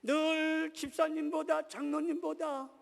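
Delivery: high, loud, slow drawn-out proclamation; emphatic preaching. Real, not acted. Preacher ranks Jesus above deacons and elders, emphatic preaching. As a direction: stretch it out and proclaim it. Preacher drives home the comparison.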